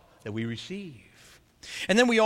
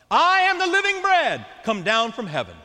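shouted proclamation; 6 semitones higher, 4 dB louder than usual. Acted. Jesus repeating his claim to grumbling crowd, shouting. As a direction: shout the whole line, higher and louder.

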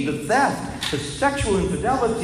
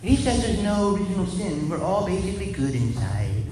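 higher, lightly voiced old nun; a hint of the sister's earnest naivety; mildly mocking the sister's view; 5 semitones higher, 4 dB quieter than usual. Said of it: "She said".

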